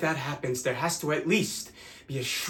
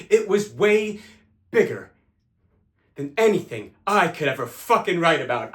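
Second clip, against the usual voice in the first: louder, emphatic outburst; impassioned insistence; 3 semitones higher, 6 dB louder than usual. Acted. Confessing to a one-night lover how big it was, impassioned. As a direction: insist on it, hitting the words hard.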